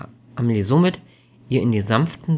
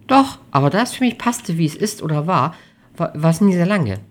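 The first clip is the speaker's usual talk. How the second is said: lightly voiced higher character; a hint of an earnest girlfriend; young woman answering; 6 semitones higher, 5 dB louder than usual. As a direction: answer defensively, a little higher and louder. Amelie's voice in dialogue.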